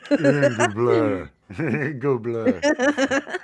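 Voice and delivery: laughing through the name, slow. Real, not acted. Husband repeating the funny name while laughing. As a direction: chuckle the name out slowly.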